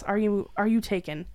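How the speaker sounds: ordinary delivery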